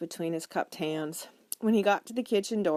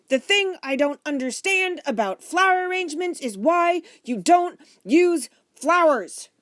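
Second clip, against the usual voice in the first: raised, high-pitched, emphatic complaint; exasperated shouting; 10 semitones higher, 9 dB louder than usual. A narrator's reading. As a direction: force the thought out over everyone, loudly. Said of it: "Mister Franklin shouted louder than anyone".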